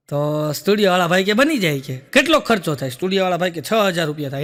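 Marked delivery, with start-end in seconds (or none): none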